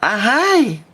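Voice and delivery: high gleeful "Ahai"; high catchphrase whoop. Acted. Streamer shouting his catchphrase to chat, gleeful. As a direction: sing out the catchphrase.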